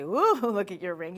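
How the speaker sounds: funny voice. Shown as a demonstration. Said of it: "You say it in that funny voice".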